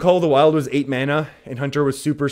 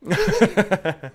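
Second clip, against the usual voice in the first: real high burst of laughter; quick giggling burst of amusement; laughing; 7 semitones higher than usual. Real, not acted. Streamer amused by chat teasing the accent, laughing.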